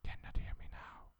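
unvoiced whisper. Real, not acted. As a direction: whisper into the mic. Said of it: "I have to whisper".

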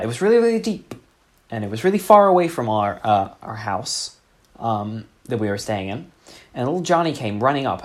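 ordinary talk

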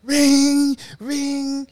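high, sustained ringing tone; imitated phone ring. Storyteller imitating the phone in his high daze, sustained high rings. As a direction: hold each ring long and high. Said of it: "My phone is not ringing".

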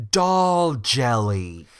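slow, loud and drawn out; dramatic drawn-out announcement; ominous reveal. Acted. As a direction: land it like a horror sting.

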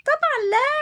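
high, emphatic little giraffe; giraffe Warda replies. Acted. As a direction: answer as if it's obvious. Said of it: "ردت وردة". (وردة ترد بتأكيد)

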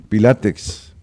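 Pilates with a crisp final s; stressed final s. Shown as a demonstration. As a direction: pronounce Pilates with the s. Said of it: "No, de pilate no, de pilates".

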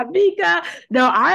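high, loud laugh through the name. Real, not acted. Host laughs at her guest Mika's final word, laughing. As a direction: burst out laughing as you say it.

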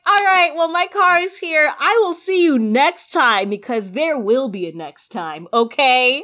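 cheery playful goodbye; excited. Retold or imitated. Saying goodbye to the gentleman at valet, bubbly and loud. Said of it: "And then I was like".

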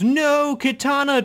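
high-pitched mock cry of despair; mock anguished cry. Critic retelling the hero losing his companion, high mock cry. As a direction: call out in hammed-up alarm. Acts hero crying out in film.

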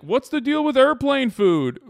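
high, loud hack comic voice; mock standup. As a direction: ham up a cheesy standup bit.

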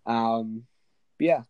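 ordinary talk, nothing marked

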